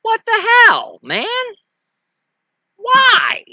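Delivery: sudden high, loud indignant cry; furious what-the-hell shout; outraged outburst. Real, not acted. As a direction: erupt in disbelief at the news. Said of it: "I get really excited over stupidity".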